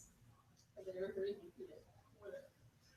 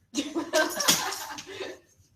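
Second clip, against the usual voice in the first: loud, breathy burst of laughter; 6 semitones higher, 13 dB louder than usual. Real, not acted. Cracking up after the false alarm, laughing hard.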